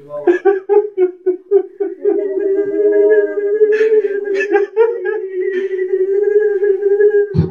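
overwhelmed shout of joy and tears; emotional cry-laugh. Real, not acted. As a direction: break into crying laughter. Overcome while telling testimony.

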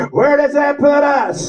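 normal talking voice

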